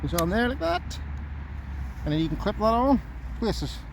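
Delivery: ordinary delivery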